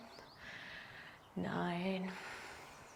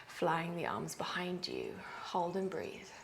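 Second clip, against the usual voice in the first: whispered pose cue; a yoga teacher whispering into the pose; 4 semitones lower, 12 dB quieter than usual. Real, not acted. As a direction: let the words fall to a whisper. Cue in flying warrior.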